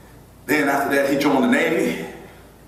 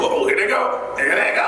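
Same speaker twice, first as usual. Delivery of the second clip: put-on kids teasing; 7 semitones higher than usual. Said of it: "Even our kids go".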